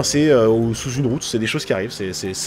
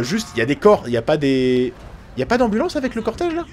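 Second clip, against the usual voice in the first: normal talking voice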